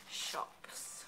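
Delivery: breathy storybook whisper on one word. Acted. Parent reading picture book to child, whispering the word. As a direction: drop to a whisper for suspense.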